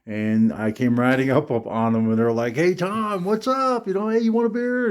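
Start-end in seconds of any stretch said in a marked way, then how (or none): 2.6-4.9 high, fast imitation of the brothers calling out; mock party bro. Older brothers calling to a teen at their kegger, mock party voice.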